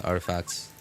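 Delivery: ordinary delivery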